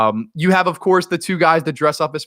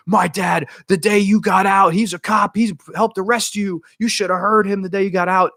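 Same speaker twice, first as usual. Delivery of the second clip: raised-pitch agitated rant, not a full scream; mock yell; 6 semitones higher than usual. Sean ranting at his captor about his dad, mild mock yell. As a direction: imitate someone yelling, held back. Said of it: "Sean starts screaming at her".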